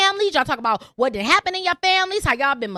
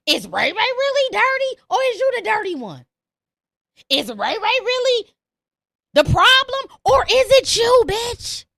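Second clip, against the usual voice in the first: building attack; 5 semitones higher than usual. Real, not acted. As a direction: build up to the insult.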